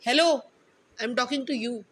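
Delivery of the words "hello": higher, louder "Hello" to get a reaction; loud call. Real, not acted. Mother calling to distracted child.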